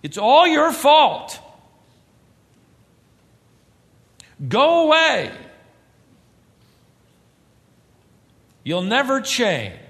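loud, high, bitter outburst; cursing words. Acted. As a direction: hurl blame at someone. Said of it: "That's calling down curses".

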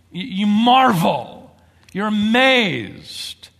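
slow, loud awed delivery; marveling. Shown as a demonstration. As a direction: drag it out in amazement. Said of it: "reaction of astonishment".

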